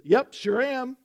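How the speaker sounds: squeaky mock dog answering the man; talking dog pipes up. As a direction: pipe up brightly like a little dog. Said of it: "the dog pipes up and says".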